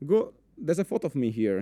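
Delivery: usual speaking voice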